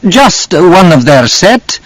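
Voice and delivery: desperate. A narrator's reading. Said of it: "Lydia said desperately".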